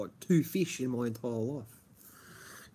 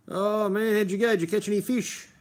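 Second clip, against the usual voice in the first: cheery greeting; 8 semitones higher, 5 dB louder than usual. Acted. Reading fans' live chat aloud.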